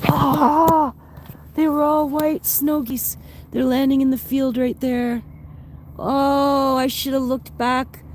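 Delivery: high, slow wonder; awed delight. Real, not acted. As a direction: marvel at the flock. Sees flock of snow geese.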